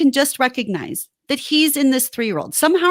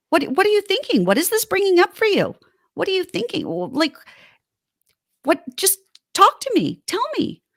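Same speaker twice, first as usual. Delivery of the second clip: an acted tender wife's voice, pitched up; gentle caring wife; 4 semitones higher than usual. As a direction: coax him tenderly to open up. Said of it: "But just".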